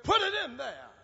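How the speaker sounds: preacher voicing a firm insistence; retold command. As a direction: punch it out as an urgent command. Rider commands the boy.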